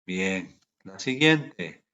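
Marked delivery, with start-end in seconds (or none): none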